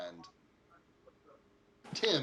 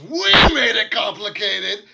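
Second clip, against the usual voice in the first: furious full-volume shout, pitched way up; livid dwarf; 10 semitones higher, 19 dB louder, slower than usual. Acted. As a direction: roar it in outrage, drawn out. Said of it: "he's just fucking, like, livid".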